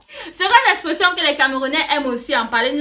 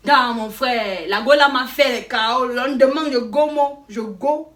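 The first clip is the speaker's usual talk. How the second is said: slang sentence. Shown as a demonstration. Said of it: "dans une phrase".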